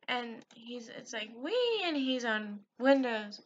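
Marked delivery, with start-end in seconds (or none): 1.4-1.8 high, gliding "wee"; imitated gleeful squeal. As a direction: mimic a little high-voiced whee. Imitates the guy flying along.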